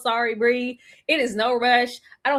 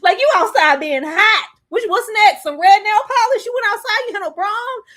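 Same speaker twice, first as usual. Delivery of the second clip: mock outrage; 9 semitones higher, 7 dB louder than usual. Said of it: "that be like".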